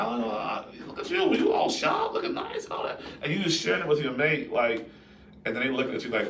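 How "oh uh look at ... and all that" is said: high, sing-song compliment; flattering stranger. Retold or imitated. Stranger at the store complimenting the husband, high and gushing. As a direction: gush over how sharp he looks, pitched high. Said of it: "this person's like".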